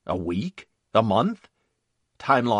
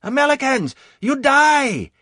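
put-on enemy soldier's shrieked taunt in accented English; high-pitched accented taunt; 8 dB louder than usual. A narrator's reading. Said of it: "bellowing taunts in high-pitched accented English".

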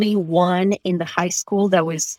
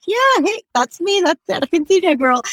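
high, bright proud hey; proud cheerful reply; 9 semitones higher than usual. Retold or imitated. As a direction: own the nickname gleefully. Retelling proudly embracing nickname.

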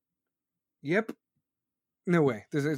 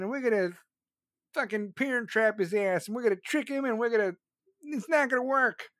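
mocking Democrats; 5 semitones higher than usual. Vlogger mocks Democrats scheming against Trump, higher sneering whine. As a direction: mimic the plotting opponents with scorn. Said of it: "and be like".

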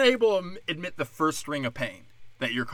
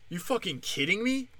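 usual speaking voice

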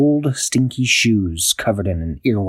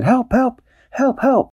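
calling for help; slower than usual. Acted. Dashy calling her friends for help, high panicked cries. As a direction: call out in panic. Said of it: "Dashy said".

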